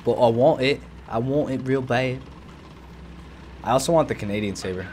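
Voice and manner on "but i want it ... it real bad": faux Cockney accent. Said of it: "What accent is that?".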